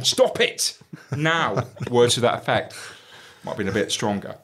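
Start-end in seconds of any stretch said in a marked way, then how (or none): 0.0-1.6 sharp high command. Retold or imitated. Pinning boss to wall.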